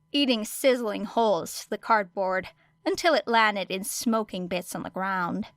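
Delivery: ordinary delivery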